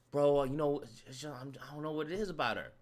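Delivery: low, soft, halting confession; sheepish boyfriend. Acted. Smitten man explaining himself to his friend, sheepish. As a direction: fumble softly for an explanation.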